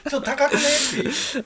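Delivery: high, loud laughing aside. Real, not acted. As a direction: laugh through the words. Laughing at glamping prices.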